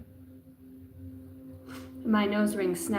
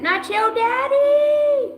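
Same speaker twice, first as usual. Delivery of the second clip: very high, slow, loud squeal; high slow catchphrase squeal; put-on catchphrase voice; 13 semitones higher, 8 dB louder, much slower than usual. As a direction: stretch it out in a silly falsetto.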